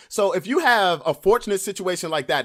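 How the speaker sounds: normal talking voice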